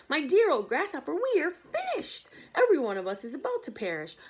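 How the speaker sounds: high, loud panicked doom; despair. Acted. Earthworm tells grasshopper they will perish, high and loud. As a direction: wail that all is lost.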